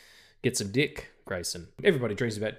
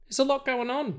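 silly high echo; 13 semitones higher than usual. Pretend. Echoing own line in silly voice.

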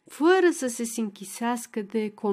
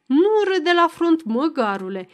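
snapping rebuke; 6 semitones higher, 5 dB louder than usual. Acted. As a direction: bark at the boy for laughing in line. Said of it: "se răstește Macedon".

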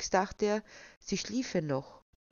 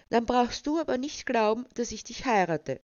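higher, louder angry retort; indignant outburst; 6 semitones higher, 4 dB louder than usual. A narrator's reading. Fiancée threatens to call off the wedding, indignant. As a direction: burst out crossly, pitch up. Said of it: "entfuhr es Vanessa erbost".

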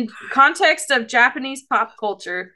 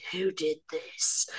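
menacing; 7 semitones lower, 12 dB quieter, slower than usual. Retold or imitated. Honey finds his dirty bunny.

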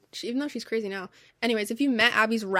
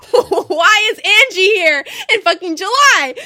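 high, loud, slow laughing shout; loud laughing outcry; laughing disbelief; 9 semitones higher, 11 dB louder, slower than usual. Retold or imitated. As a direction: shriek it through laughter. Friends shouting, laughing at elf.

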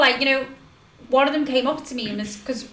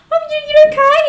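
loud, very high awkward squeak; voice way higher; 12 dB louder, faster than usual. Retold or imitated. Greeting his girlfriend awkwardly, voice shooting way higher. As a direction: shoot your voice right up as you ask. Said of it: "my voice went, like, five times higher".

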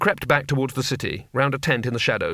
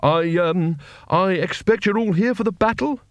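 nervous wizard; 6 semitones higher than usual. Acted. Rincewind voiced.

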